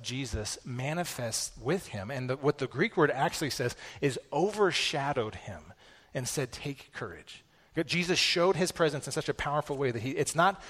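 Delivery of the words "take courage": softer, slow tender words. Acted. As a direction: say it gently, like a comfort. Jesus' words read softly.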